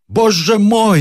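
loud, high exclamation of dismay; alarmed general's outcry; crying out. A narrator's reading. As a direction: cry out in horror, high and forceful. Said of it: "вскричал генерал".

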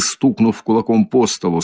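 plain reading voice

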